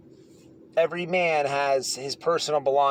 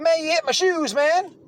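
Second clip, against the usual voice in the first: much higher, whiny insistence; hammed-up high complaint; mimicking the upset inmate, pitched way up; 12 semitones higher than usual. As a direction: squeak it up like an aggrieved guy. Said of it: "He's like".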